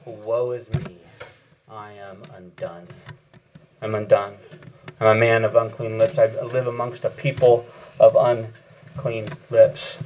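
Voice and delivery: low, grave lament of woe; dismayed Isaiah. Acted. Isaiah confessing before the holy God, in low dismay. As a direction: mourn your own unclean lips, voiced low.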